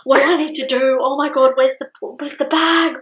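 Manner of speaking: loud, high, flustered questions; mock panic. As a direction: imitate someone frantic and just woken. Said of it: "he's just panicking".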